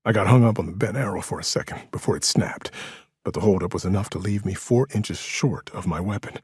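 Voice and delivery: usual speaking voice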